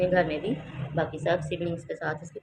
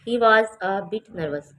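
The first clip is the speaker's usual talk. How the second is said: louder, very slow reading; 6 dB louder, much slower than usual. Shown as a demonstration. Teacher reading the story line to students, loud and slow. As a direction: project it slowly to the class. Reads the paragraph aloud.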